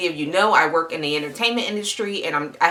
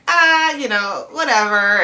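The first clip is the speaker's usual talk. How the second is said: dismissive whatever; 5 semitones higher than usual. Retold or imitated. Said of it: "I was like".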